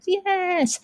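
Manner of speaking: put-on high kid's voice calling out no; kids answering. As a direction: answer like a classroom of little kids. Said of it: "And they'd".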